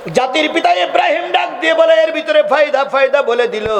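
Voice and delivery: high-pitched sermon cry, easing down; impassioned preaching. Real, not acted. Preacher telling Ibrahim's story to the crowd, high impassioned delivery.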